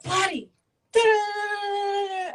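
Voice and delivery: very high-pitched panicked babble; imitated flustered outcry. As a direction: blurt like someone caught red-handed. Acts out him caught off guard.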